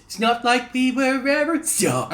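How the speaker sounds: high, slow, exaggerated copy of a singer; mocking singer impression. Comedian mimicking the woman's lyrics, mocking. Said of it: "Why does she say it like that".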